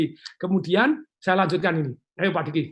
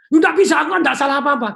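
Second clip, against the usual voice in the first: angry refusal; 9 semitones higher, 9 dB louder than usual. Retold or imitated. Spouse refusing to apologise.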